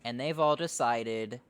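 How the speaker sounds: ordinary talk, nothing marked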